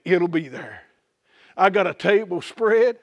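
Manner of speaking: ordinary delivery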